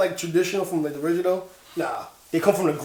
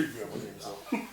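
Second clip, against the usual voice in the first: voice changer; 10 semitones lower, 16 dB quieter, slower than usual. Pretend. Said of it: "you gotta get the voice changer".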